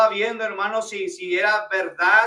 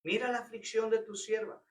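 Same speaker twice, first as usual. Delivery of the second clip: softer, hushed restatement; quiet repeat; 10 dB quieter than usual. Shown as a demonstration. Pastor repeating Ana's plea to the church, softly. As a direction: let "Mira la aflicción de tu sierva" fall quietly. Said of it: "Eso es clamor".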